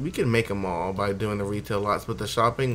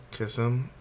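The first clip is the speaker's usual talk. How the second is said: ordinary talk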